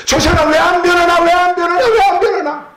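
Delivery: praying believer venting, high and exasperated; frustrated complaint. Acted. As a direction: plead in frustration at someone who won't change. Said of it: "기도하다가 낙심될 때가 있잖아요".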